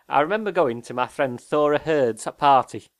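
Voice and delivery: Yorkshire playwright impression; Alan Bennett. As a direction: reminisce like a Yorkshire man of letters. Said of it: "do an impression of one".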